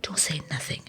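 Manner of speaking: hushed coach, whispered and secretive; whispered warning. Retold or imitated. Coach hushing her to secrecy.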